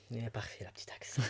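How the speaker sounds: unvoiced teasing whisper; playful whisper. Real, not acted. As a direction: whisper it as a cheeky aside. Joking about her Belgian accent.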